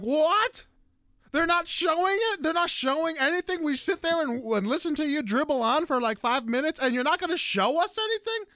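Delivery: high, incredulous outburst; mock outraged yell at the screen; retold yelling. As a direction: raise the pitch in angry disbelief. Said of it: "and I'm yelling".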